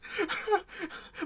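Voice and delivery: high wordless sob; sobbing wail. Acted. Grieving mother bursts into tears, high wordless sob. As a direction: break into a sob. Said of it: "stutters and what goes".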